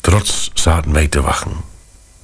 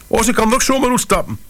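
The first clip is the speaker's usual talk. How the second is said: panicked lady; faster than usual. Acted.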